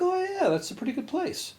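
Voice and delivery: high teasing friend voice; mocking high voice. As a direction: play the guys as sing-song and knowing. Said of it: "And they're like".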